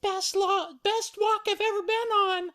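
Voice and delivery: very high falsetto mother bear; Mama Bear. Acted. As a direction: pitch it way up, pleased storybook mother. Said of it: "Uh, Mama Bear".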